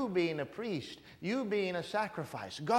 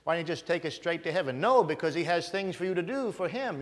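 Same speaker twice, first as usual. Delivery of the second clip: normal talking voice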